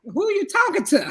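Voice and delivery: high retold comeback with a laugh; taken-aback retort. Wife recounts answering her husband on the call, taken aback. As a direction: act out being taken aback, half laughing.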